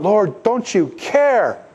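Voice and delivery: mock the frightened disciples' whine; a slow, high, pleading wail; panicked disciples. Apostles cry out in storm.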